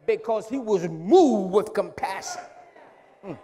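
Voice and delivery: loud, high climactic preaching; preaching at full force. Acted. Preacher to congregation about compassion, full force. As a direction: shout it home to the church.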